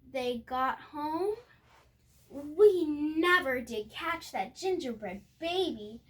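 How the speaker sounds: plain reading voice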